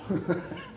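a short light chuckle; brief chuckle. Real, not acted. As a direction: chuckle at the joke. Laughs at own example.

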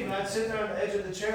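normal talking voice

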